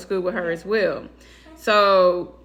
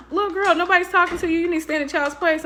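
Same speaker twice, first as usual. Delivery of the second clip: scolding big sister; 6 semitones higher, faster than usual. Retold or imitated. Daughter scolds a younger girl.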